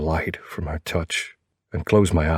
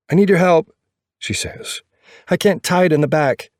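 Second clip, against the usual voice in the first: female character voice; 12 semitones higher, 4 dB louder than usual. A narrator's reading. Narrator voicing girlfriend's request.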